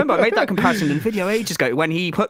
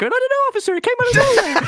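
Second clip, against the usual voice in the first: squeaky high voice, caught off guard; high-pitched shocked voice; 3 dB louder than usual. Pretend. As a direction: voice jumps way up in panic. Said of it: "my voice goes high when I'm in shock".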